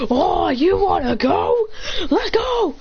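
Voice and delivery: high, drawn-out taunt rising into a cry; cocky challenge. Acted. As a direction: relish the fight, stretching it out. Acted character taking up challenge.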